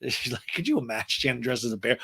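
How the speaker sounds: normal talking voice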